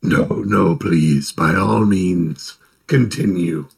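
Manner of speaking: deep, slow, menacing undead voice; a slow sinister lich being sarcastically polite; ancient lich voice. Acted. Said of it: "that lich voice sounds so awesome".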